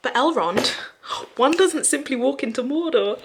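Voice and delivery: hammed-up Boromir line; meme retort. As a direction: quote the meme defiantly.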